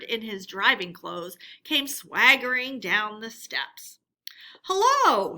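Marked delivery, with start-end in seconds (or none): 4.6-5.4 much higher, louder greeting; cheerful cry. Acted. Toad greeting friends on the steps, cheerful. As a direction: hail your friends with glee. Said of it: "he cried cheerfully".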